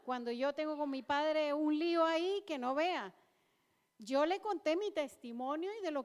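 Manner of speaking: impassioned church exhortation; preaching at full force. Real, not acted. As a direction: drive each line home to the congregation.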